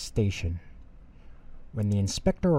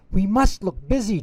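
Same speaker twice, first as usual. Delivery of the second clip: high, louder urgent cry; urgently; 10 semitones higher, 6 dB louder than usual. Acted. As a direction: blurt it out in a rush of alarm. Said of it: "Thomas said urgently".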